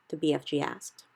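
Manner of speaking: plain reading voice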